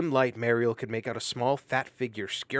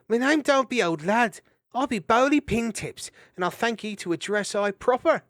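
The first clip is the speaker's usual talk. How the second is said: indignant hedgehog; 12 semitones higher, 8 dB louder than usual. Acted. Indignant Bowley.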